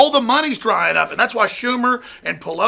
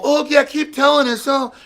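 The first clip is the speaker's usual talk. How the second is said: sarcastic mock; 7 semitones higher, 3 dB louder than usual. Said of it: "they're all".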